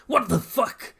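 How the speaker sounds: high, startled outburst; freaking out. A narrator's reading. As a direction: blurt it in alarm. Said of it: "he freaks out saying".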